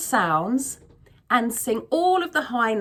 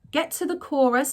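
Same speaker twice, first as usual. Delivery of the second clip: ordinary delivery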